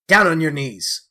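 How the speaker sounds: loud growled command. A narrator's reading. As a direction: bark the order down. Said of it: "growled the man".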